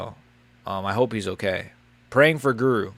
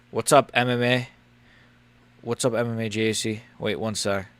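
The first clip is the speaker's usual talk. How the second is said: ordinary talk, nothing marked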